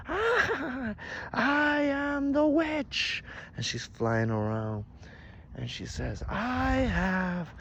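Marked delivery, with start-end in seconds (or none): 0.1-3.2 very high, slow, loud shriek; screaming witch. A narrator's reading. Witch flies screaming through the night, shrieked proclamation. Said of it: "she starts screaming in the night". 6.3-7.5 high, loud, drawn-out proclamation; triumphant cry. A narrator's reading. Flying witch claims her power, high triumphant cry.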